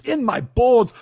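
high, loud mock wail; dramatic shout. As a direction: bellow it like a tortured soul. Builds to a mock outburst.

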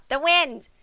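a grandma fumbling for the word; lightly voiced grandma. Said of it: "Here's Grandma".

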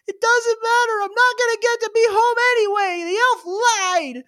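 put-on high, dejected child voice; a kid whose Christmas wish fell apart; sad little boy. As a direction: Richie sulking to his grandmother. Child Richie sadly speaks to grandmother.

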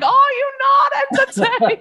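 high, slow mock gladiator cry; hammed-up arena call; Russell Crowe impression. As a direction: replay the grand movie shout, close to laughing. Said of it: "You sounded exactly like him".

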